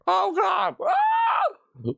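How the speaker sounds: very high screamed oh God; demo scream. Shown as a demonstration. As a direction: shriek as if jump-scared. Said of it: "when I scream something".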